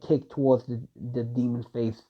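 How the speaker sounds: plain reading voice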